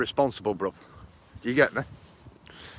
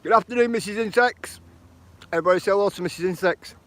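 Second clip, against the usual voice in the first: cheery greeting; 7 semitones higher than usual. Real, not acted. Greeting chat viewer on livestream.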